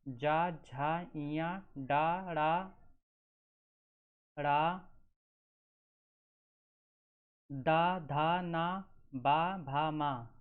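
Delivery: syllables sounded out one by one; teacher sounding out. Shown as a demonstration. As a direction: pronounce each voiced consonant distinctly. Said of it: "जैसे".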